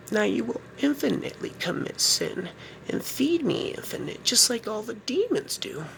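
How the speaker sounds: high, hushed, menacing witch; whisper-taunt like a devouring witch; Salem witch. Acted. I am the pissed off bitch witch from Salem Village.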